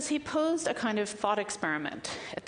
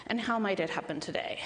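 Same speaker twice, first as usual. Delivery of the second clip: ordinary delivery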